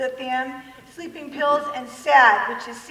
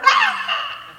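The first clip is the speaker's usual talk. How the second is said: short high-pitched comic scream; exasperated scream; 7 dB louder than usual. Retold or imitated. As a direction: scream out in frustration, played for laughs. Acts out reaction to perfect husband.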